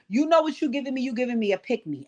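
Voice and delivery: ordinary talk, nothing marked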